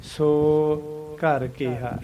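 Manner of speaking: ਸੋ stretched, a gap, then ਘਰ ਕਿਹਾ; pause after so. Shown as a demonstration. Teacher modelling ਸੋ ਘਰ for students, slow with a gap. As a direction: split ਸੋ from ਘਰ with a held pause. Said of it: "ਐਂ ਬੋਲਣਾ ਅਸੀਂ".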